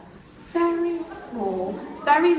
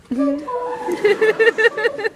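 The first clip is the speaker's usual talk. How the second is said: high, louder laughing call; 9 semitones higher, 6 dB louder than usual. Shown as a demonstration. Teacher calls the dragons again, louder and laughing. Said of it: "you just go".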